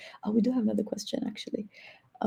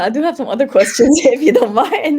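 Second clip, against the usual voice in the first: laughing; 5 semitones higher, 7 dB louder than usual. Real, not acted. Laughing while asking more questions.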